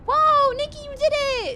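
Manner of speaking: excited cheer. Real, not acted. Streamer cheers a viewer who finished, high excited cheer.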